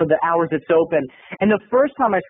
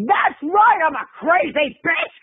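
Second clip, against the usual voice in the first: crazy duck lady; 9 semitones higher, slower than usual. Retold or imitated. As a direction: crow it proudly like a madwoman. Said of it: "And she went".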